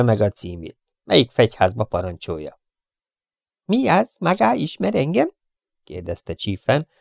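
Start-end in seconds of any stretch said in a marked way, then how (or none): 3.6-5.3 high, curt, insolent gangster voice; rude Chiefen. Acted. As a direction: sneer the intruder off. (magas, pimasz, goromba hang)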